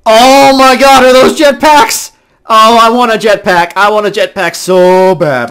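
loud, high fanboy excitement; excited outburst. Real, not acted. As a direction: burst out high and loud with longing. Spots jetpacks and badly wants one.

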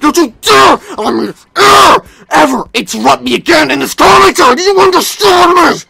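loud, high threatening yell; an all-out menacing outburst; furious threat. Acted. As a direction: scream him into silence. Threatening William for interrupting.

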